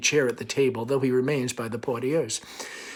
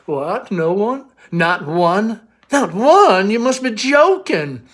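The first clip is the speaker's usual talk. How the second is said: airy mock surprise; 7 semitones higher, 9 dB louder, much slower than usual. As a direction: feign shock, building up. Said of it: "reappearing airily".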